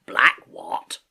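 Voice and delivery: loud, gruff sailor's query; Long John Silver. Acted. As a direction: demand it in a seafarer's voice. Silver questions the boy.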